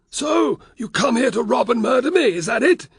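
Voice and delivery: high, loud nervous bluster; a frightened man trying to sound bold; blustering Varus. A narrator's reading.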